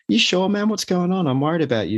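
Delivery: high-pitched, concerned questioning; concerned mate. Acted. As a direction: press a friend who is brushing it off. Mate checks on anxious friend.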